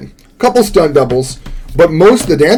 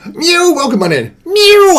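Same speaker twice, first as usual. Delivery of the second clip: odd squeaky greeting; 13 semitones higher than usual. Real, not acted. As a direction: greet them in a silly high voice. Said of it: "I don't know why I said it like that".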